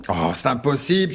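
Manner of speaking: ordinary talk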